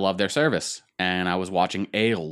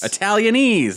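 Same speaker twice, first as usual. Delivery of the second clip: high, loud triumphant repeat; excited; 13 semitones higher, 7 dB louder than usual. Real, not acted. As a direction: shout it like you nailed it. Confirms the word triumphantly.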